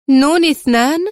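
high, loud, incredulous question; indignant exclamation. A narrator's reading. Mona scoffing at his title, indignant exclamation. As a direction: cry it out in disbelief, voice rising. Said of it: "هتفت مستنكرة".

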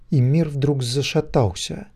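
ordinary delivery, nothing marked

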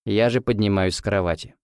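usual speaking voice

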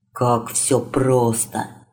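slow, low envious thought; envy it under your breath, slowly. Acted. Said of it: "с завистью подумал".

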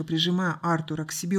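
ordinary delivery

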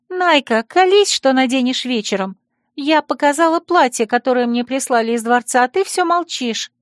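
put-on high chirpy girl voice; cartoon princess. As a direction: tease her friend in a high chirp. Tsaria's high voice continues.

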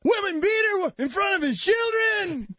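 high-pitched mock tirade in an accent; ranting father impression. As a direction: keep hammering it on one high note. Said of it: "It's the same accent".

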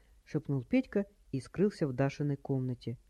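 plain reading voice

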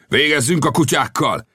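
high-pitched attack cry; shouted. Acted. Bearded officer pointing at the enemy, crying out. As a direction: call the men on to attack. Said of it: "kiáltotta a szakállas tiszt".

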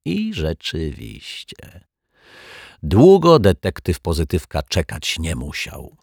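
usual speaking voice

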